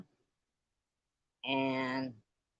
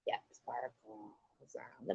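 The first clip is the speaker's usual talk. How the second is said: whisper; 6 semitones lower, 10 dB quieter than usual. Real, not acted. Checking the board and whispering the answer to herself. Confirming answer under her breath.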